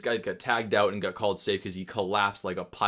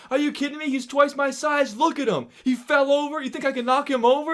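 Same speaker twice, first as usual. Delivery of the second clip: high-pitched, outraged pleading; indignant protest; 4 dB louder than usual. Retold or imitated. Ejected player arguing with the ref, high indignant protest. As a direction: argue incredulously with the ref. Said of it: "And I go".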